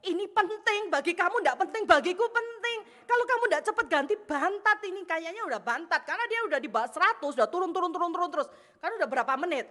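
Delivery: high, loud acted arguing; urgent insistence. Wife insists husband change the gas quickly, urgently. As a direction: press the point hard and anxiously.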